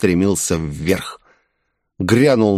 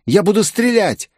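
much higher, urgent threat; Виктория; 11 semitones higher than usual. A narrator's reading. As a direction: cry out as the desperate young woman. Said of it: "прокричала Виктория". (отчаянный высокий крик)

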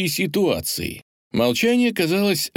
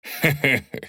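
quiet chuckle; 3 semitones higher than usual. A narrator's reading. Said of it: "прозвучал тихий смех".

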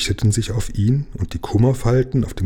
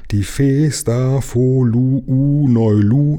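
slow, raised, syllable by syllable; pompous declamation; 5 semitones higher, 4 dB louder, slower than usual. A narrator's reading. King announces an official abbreviation to subjects, pompous and drawn out. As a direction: chant each syllable with official weight.

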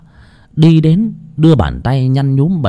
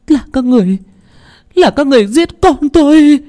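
much higher, louder anguished wailing; wailing grief; 14 semitones higher, 4 dB louder than usual. A narrator's reading. Mother over her dead son, wailing and accusing. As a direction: cry out accusations through sobbing. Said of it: "khóc lóc thảm thiết".

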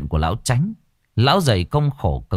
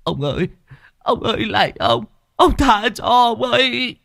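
high, pleading cry for mercy; whimpering plea; 13 semitones higher than usual. A narrator's reading. Beaten servants begging the old master for mercy, high and tearful. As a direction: beg the master to stop, voice breaking upward. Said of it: "miệng không ngừng mếu máo".

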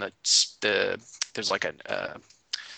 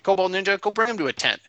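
high, loud commanding order; bossy cult leader; 7 semitones higher, 4 dB louder than usual. Retold or imitated. Dragon Man ordering Kobold Ninja to take him, high bossy voice. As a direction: bark the order up high like a pompous boss.

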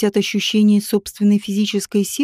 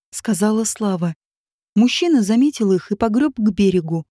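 usual speaking voice

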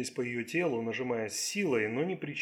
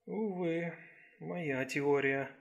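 sad; 3 semitones higher, much slower than usual. A narrator's reading. Policewoman giving up on her theory, sad. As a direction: let it sink slowly with regret. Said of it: "печально подумала Сакс".

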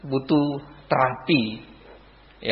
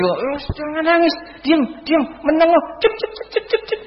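put-on high squeaky shushing, as to a baby; hammed-up high-pitched "don't cry" with clucking; mock hushing; 12 semitones higher than usual. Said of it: "itu beda reaksi dan tanggapan". (menirukan orang mendiamkan tangisan, nada tinggi)

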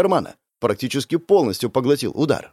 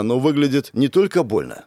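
ordinary delivery, nothing marked

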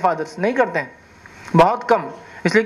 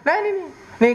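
put-on high, quick run of no's like a guilty kid; hasty child denial; 11 semitones higher, much faster than usual. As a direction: deny it fast like a caught child. Child hastily denies.

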